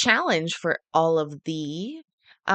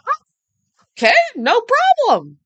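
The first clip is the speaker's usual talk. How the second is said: breezy mock "no problem", pitched up and loud; high, easygoing smoker voice; 11 dB louder, faster than usual. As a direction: play the caught smoker, unbothered. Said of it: "the guy was like".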